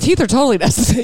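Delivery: parroting the guy's claim, fast; mocking quote. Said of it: "I was like".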